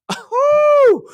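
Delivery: sudden high loud whoo; excited whoo mid-rant. Real, not acted. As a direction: let out a shrill whoo. Host exclaims mid-sentence.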